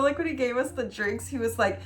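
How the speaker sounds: ordinary talk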